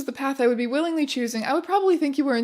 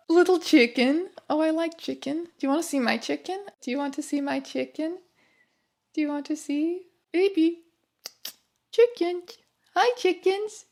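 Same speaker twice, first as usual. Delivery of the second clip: put-on high cooing baby talk; 4 semitones higher than usual. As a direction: coo like talking to pets. Cooing to chicken pictures.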